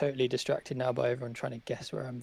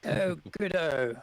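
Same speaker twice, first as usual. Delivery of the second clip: slow, hammed-up British "good-o"; hammed-up British accent; 3 semitones higher, 4 dB louder, slower than usual. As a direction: lay the British accent on thick. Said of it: "some love in the chat about your British accent".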